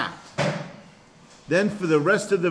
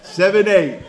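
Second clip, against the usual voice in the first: much louder, higher, playful call-out; jokey, raised-voice repeat; mock forceful announcement; 6 semitones higher, 10 dB louder than usual. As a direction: belt out the verse number for laughs. Jokingly re-announces verse amid laughter.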